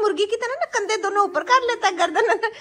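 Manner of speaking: giggling while complaining about her son; laughing. Real, not acted. (हँसते हुए बोलना)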